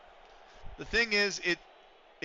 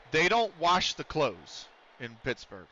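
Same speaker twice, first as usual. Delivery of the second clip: ordinary delivery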